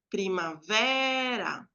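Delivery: primavera with VE held very long; stressed syllable demo. Shown as a demonstration. Teacher showing the tonic syllable, dragging out VE in primavera. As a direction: stretch the VE in primavera slowly. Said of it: "e é a penúltima, ... que é ... tônica".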